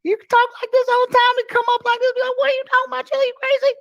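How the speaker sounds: extremely high, excitable squeal of banter; high-pitched friend impression. Imitating his Brooklyn friend teasing him, squeaky high. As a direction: push the voice way up, playfully outraged. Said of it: "might need to get his voice box checked".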